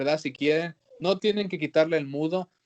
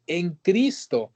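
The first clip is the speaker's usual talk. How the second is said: slow weighty reading; 2 semitones higher, much slower than usual. Acted. Preacher stresses the phrase.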